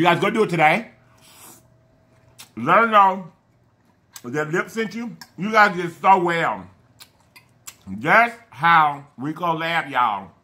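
chewing food while speaking; speaking between bites of salad; talking with mouth full. Real, not acted.

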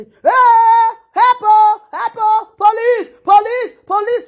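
high, loud cries for help; imitated panic shout. Mimicking parents screaming for help on social media. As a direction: yell for help and police like a frantic parent. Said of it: "the way they take they shout".

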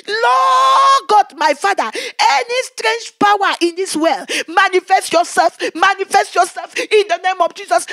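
loud, high deliverance prayer; retold shout of prayer; forceful prayer. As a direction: command the spirit at full strength. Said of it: "I was praying with all my strength".

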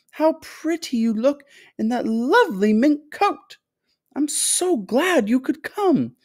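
high, gushing, effusive host; excitable fluty factory owner. A narrator's reading. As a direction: greet them in a high, fluty, delighted voice. Story figure: fluty factory owner greeting.

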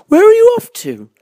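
high, loud curious question; high-pitched bird character; owl character. A narrator's reading. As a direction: hoot the question out as a friendly owl. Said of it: "he said".

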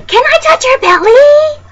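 very high, loud squeal; gushing stranger. Acted. Strangers coming up to a pregnant woman, gushing loudly. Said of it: "people ... all coming up to you and saying".

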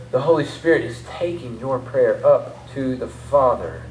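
usual speaking voice

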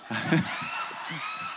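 shrieking laugh; real squeal of laughter; high squealing laugh. Real, not acted. Preacher laughing at own joke.

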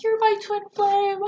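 high, loud, drawn-out wail; imitating her own hysterical cry, breaking into laughter; mock hysterical crying. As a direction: wail it like a hammed-up sobbing confession. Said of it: "I'm hysterically crying".

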